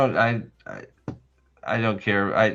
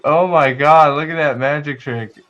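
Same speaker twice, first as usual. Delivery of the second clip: gushing fan; 4 semitones higher, 8 dB louder than usual. Retold or imitated. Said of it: "she's like".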